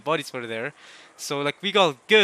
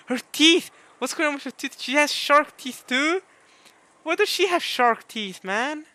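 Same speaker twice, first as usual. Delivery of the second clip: surprised; 9 semitones higher than usual. Real, not acted. Shocked at shark teeth.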